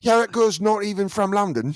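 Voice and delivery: high, scornful East London voice; East London accent. Retold or imitated. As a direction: mimic an East London girl calling out a fake. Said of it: "a girl from East London".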